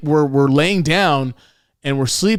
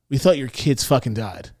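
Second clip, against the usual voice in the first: normal talking voice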